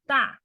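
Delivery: 大 with a sharp falling tone; 大 fourth tone, falling. Shown as a demonstration. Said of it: "falling tone".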